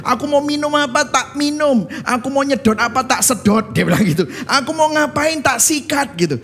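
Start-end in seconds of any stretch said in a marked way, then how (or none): 0.1-3.8 retold daredevil bravado. Retells thrill-seeking boss's philosophy. 4.5-6.2 retold daredevil bravado. Boss's boast, retold.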